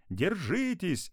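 high, drawn-out warning shout; yelling. A narrator's reading. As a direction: holler slowly stretched and high. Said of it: "орал папа".

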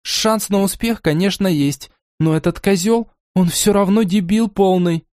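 aggressive. Acted. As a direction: spit the insult out. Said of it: "агрессивно добавила".

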